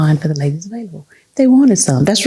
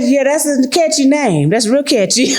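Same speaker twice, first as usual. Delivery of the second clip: wry sarcasm; 6 semitones higher than usual. Retold or imitated. Wry remark on product name.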